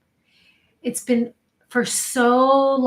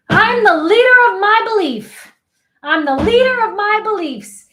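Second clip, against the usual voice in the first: loud, high, emphatic declaration; forceful affirmation; 9 semitones higher, 10 dB louder than usual. Shown as a demonstration. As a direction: step in and proclaim it with conviction. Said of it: "forward and say".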